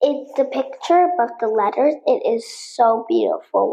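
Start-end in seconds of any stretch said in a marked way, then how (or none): none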